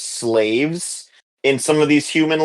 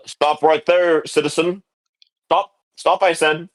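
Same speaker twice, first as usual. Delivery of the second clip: lightly voiced guard, higher pitch; a hint of an official ordering a halt; guard halting someone; 5 semitones higher than usual. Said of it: "three of the men".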